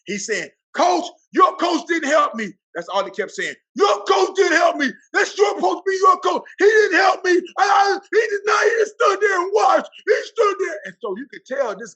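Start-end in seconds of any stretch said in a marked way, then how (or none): 0.7-2.5 high, loud, slow yell of complaint; imitating an upset coach yelling. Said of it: "Coach Falk is mad". 3.8-6.4 upset yell. Retold or imitated. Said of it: "Coach Falk is mad". 6.6-10.8 high, loud, tearful yelling; imitating a coach shouting in tears; crying shout. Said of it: "very upset".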